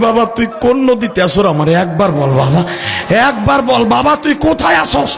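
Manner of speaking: frantic mother calling for her son, climbing in pitch; urgent, rapid maternal wail; mother crying out. Retold or imitated. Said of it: "মা চিল্লানি দিয়া কয়".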